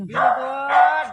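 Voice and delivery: slow praise. Real, not acted. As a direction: draw out very good to reward the pet. Praising the pet again.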